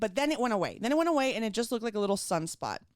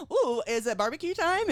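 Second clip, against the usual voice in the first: playful pitched-up quip; joking; 6 semitones higher, 4 dB louder than usual. Retold or imitated. Patient on the table trying to joke with her surgeon, playful. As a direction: toss it out as a cheeky joke, higher. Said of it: "trying to make jokes like".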